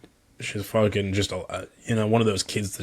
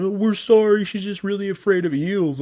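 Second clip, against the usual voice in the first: put-on kids' voice; 10 semitones higher, 5 dB louder than usual. Kids apologising for their friend.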